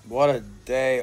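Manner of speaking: ordinary delivery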